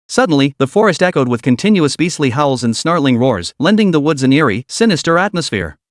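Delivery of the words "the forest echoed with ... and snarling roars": ordinary delivery, nothing marked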